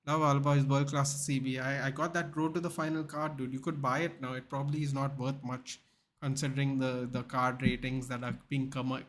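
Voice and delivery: ordinary talk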